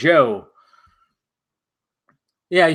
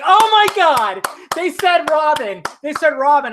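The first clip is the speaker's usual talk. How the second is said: mock gasping audience; 10 semitones higher than usual. Vlogger imitating the theater crowd, high awed gasp. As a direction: imitate the crowd's breathless shock. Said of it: "Everybody's like".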